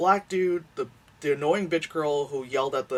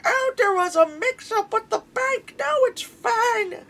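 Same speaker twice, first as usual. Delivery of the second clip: much higher, airy mock cheer slowing on the end; fake cheery girl voice; 5 dB louder, slower than usual. The girl brushing off the eviction, high fake cheer. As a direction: chirp it like a ditzy girl smoothing things over. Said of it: "she's like".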